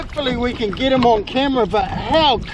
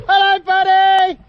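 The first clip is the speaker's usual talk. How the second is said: high, loud friendly yell; calling out; 7 semitones higher, 6 dB louder than usual. Real, not acted. Kayaker yells a greeting to the whale, loud friendly call. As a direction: call out across the water to a friend. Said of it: "we, like, yell at him".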